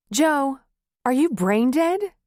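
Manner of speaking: almost yell it at him; near yell. A narrator's reading. Wife nearly yells at husband in disbelief. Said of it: "she nearly yelled".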